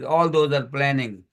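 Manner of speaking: usual speaking voice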